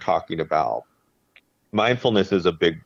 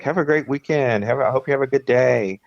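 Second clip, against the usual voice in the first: bright upbeat teller greeting, pitched up; cheerful bank teller; 7 semitones higher than usual. Retold or imitated. Teller cheerfully wishing every customer a good weekend, upbeat. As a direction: sing out good wishes to each customer. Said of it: "she was just like".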